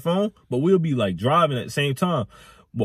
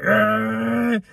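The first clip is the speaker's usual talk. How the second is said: mock effortful "argh"; strained groan; 7 semitones higher than usual. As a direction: grunt as if forcing the car past. Driver straining while passing truck.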